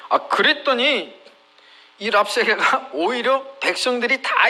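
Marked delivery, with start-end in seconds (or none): none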